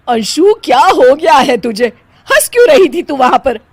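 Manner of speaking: loud, high, furious demand; mother-in-law loudly scolding her bahu; angry scolding. Acted.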